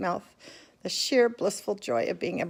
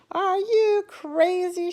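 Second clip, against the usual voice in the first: high, loud, slow outraged cry; shouted; 13 semitones higher, 6 dB louder, much slower than usual. A narrator's reading. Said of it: "shouted a woman".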